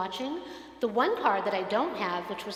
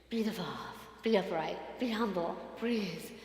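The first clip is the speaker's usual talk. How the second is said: soft, lower, calm; meditation mantra; 3 semitones lower, 6 dB quieter than usual. Shown as a demonstration. Pastor steadying herself with a mantra, softly. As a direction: chant it gently to settle yourself. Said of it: "as we do in meditation".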